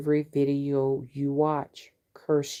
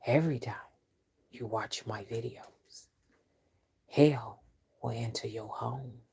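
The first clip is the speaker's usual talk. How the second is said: quiet curse on a rival; 3 semitones lower, 6 dB quieter than usual. Real, not acted. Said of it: "when she meant business".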